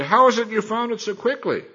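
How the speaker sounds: high-pitched, doubting question; mildly incredulous old father's query; suspicious questioning. Acted. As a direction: question it with rising pitch, not quite buying it. Suspicious father questions a quick hunt.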